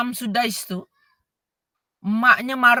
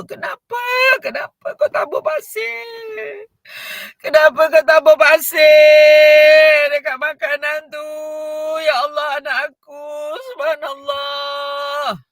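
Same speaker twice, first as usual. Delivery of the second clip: coaxing scold; 5 semitones higher, 9 dB louder than usual. Shown as a demonstration. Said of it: "kita tak faham suara tu memujuk".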